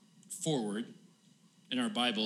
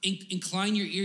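raised-pitch plea to the Lord; begging; 6 semitones higher, 3 dB louder than usual. Acted. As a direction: plead urgently, as if begging. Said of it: "He's begging with the Lord".

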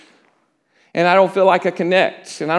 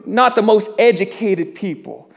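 ordinary talk

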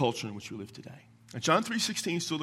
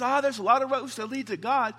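mocking everybody; 9 semitones higher than usual. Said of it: "When everybody says".

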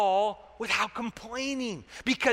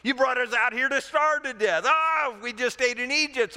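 hammed-up grievance, pitched higher; complaining; 5 semitones higher, 4 dB louder than usual. Hungry Israelites say they were brought out to starve, complaining. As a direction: pile up the complaints in mock outrage. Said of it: "And then they're complaining".

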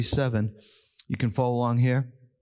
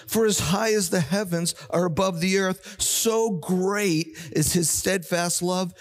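fervent scripture reading; 5 semitones higher than usual. Acted. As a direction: read the verses as rising praise.

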